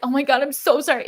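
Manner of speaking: high, fast, gushing mock sorry; frantic apology. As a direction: imitate a panicked over-apology. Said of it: "it wasn't this energy of".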